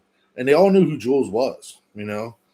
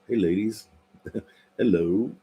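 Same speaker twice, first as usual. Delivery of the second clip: playfully seductive greeting, breaking into a chuckle; mock-flirty hello to the ladies; sexy come-on. Streamer jokingly greets the ladies, mock sexy. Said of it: "Let the sexy out".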